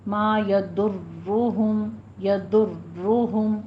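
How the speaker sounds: slow يضرهم with ر rolled just twice; double-trilled ra. Shown as a demonstration. Teacher shows the correct trill, slow demo. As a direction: roll the ر in یضرہم exactly twice, slowly. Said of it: "صرف دو را ادا کرنی ہے".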